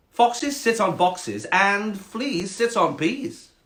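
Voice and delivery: high, very slow snobby cat. Acted. Cat schooling the frog, snobby and slow. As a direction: draw out the rule snootily. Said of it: "quite a snobby cat".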